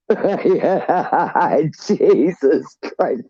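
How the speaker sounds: laughing through the words; real laughter, high and breathy with amusement; chuckling in disbelief. Real, not acted. Laughing at own story.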